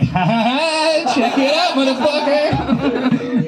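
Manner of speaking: high, slow, mocking laugh-taunt; mock bully taunt. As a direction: sneer it out like a cartoon bully.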